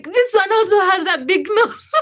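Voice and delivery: put-on gleeful kid giggling out the punchline; laughing child. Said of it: "and I was like".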